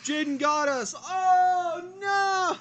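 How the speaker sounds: loud high outcry; shouting. Real, not acted. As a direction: shout it out in dismay. Reacting loudly to the prank.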